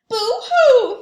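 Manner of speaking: high, loud hammed-up boo-hoo; mock sobbing. Reginald breaking down, hammed-up loud boo-hoo. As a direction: wail it like a cartoon crybaby. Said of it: "he sobbed".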